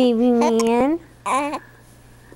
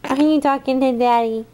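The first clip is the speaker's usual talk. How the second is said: ordinary delivery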